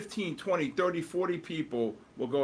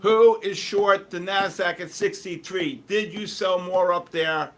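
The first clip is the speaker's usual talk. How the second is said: louder, slow call to the chat; loudly asking the stream who is short; 4 semitones higher, 9 dB louder, slower than usual. Real, not acted. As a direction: call it out slowly to the room. Calling out to chat.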